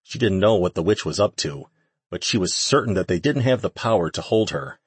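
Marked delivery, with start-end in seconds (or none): none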